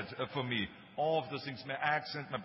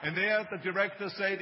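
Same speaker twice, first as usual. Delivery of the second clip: usual speaking voice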